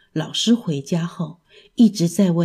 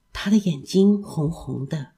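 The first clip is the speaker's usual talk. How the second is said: usual speaking voice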